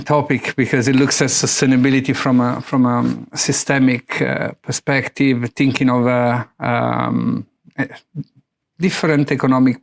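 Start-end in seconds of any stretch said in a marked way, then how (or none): none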